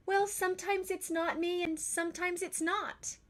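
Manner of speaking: put-on high child's voice, earnest and matter-of-fact; a kid patiently explaining the obvious; little girl explaining. Tess explains to her parents, high and earnest.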